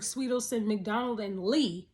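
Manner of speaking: usual speaking voice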